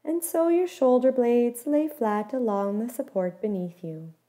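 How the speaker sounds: ordinary talk, nothing marked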